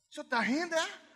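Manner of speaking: put-on high, loud hurt child's accusation; a little girl offended that God laughs; indignant daughter. Daughter scolding God for laughing, high hurt complaint. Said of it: "falou assim".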